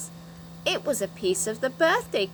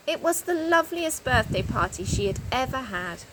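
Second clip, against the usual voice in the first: ordinary delivery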